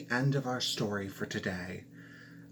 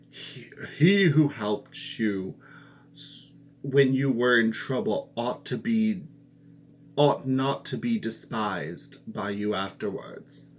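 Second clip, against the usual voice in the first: angry king; 5 dB louder than usual. A narrator's reading. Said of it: "the king grew angry and said".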